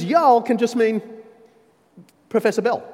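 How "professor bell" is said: normal talking voice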